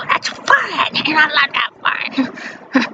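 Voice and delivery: high, then slow whispered sneer; mocking family voice. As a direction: sneer at her interests in a put-on voice. Family mocking her foreign interests.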